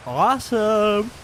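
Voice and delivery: awesome drawn out slow, high and loud; stretched-out awesome. Acted. Mocking the kid, stretching out awesome loud. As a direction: sell "awesome" with a big slow swoop.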